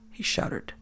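usual speaking voice

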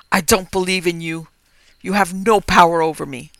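loud, defiant denial. Acted. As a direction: push back at her loudly. Jerry defies the ghost in revulsion.

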